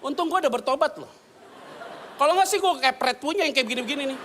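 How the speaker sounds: high, loud laughing aside; high laughing mock threat. Real, not acted. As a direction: laugh while threatening in jest. Laughs about past anger.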